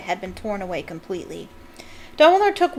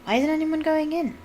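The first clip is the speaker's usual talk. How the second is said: higher, puzzled question; curious; 7 semitones higher than usual. Acted. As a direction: ask with honest curiosity. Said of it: "said Ron curiously".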